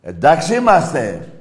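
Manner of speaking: loud, high demand for agreement; shouted challenge; shouted question. Real, not acted. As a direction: bark the question at them. Shouted question then calm answer.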